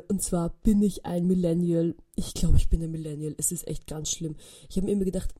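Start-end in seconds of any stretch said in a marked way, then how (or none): none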